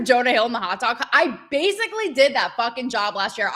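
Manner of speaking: normal talking voice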